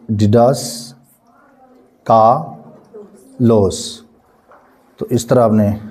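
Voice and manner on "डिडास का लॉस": डिडास spelt out slowly, syllable by syllable; teacher slowly sounding out a Greek word. Shown as a demonstration. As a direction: sound out डिडास carefully for students. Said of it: "तेज़ी में पढ़ देना".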